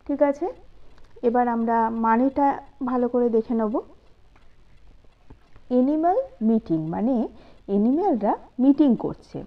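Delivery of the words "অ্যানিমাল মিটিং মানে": ordinary delivery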